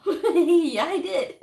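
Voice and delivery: laughing through a proud yeah, I did. Real, not acted. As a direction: giggle it out, pleased with herself. Proudly owning her achievement.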